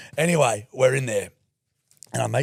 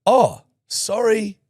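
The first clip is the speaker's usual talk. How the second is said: high, drawn-out fake sorry; exaggerated, loud mock contrition; mock apology; 11 semitones higher, 6 dB louder, much slower than usual. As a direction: overplay the apology, slow and insincere. Mock apology after being scolded.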